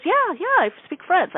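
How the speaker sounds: very high-pitched quoted client; high, insistent retold "yeah"; imitated eager reply. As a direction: play her answer high and emphatic. Imitating the client's excited answer.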